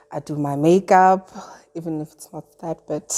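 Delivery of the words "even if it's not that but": whispered aside. Real, not acted. Trailing confession, whispered aside.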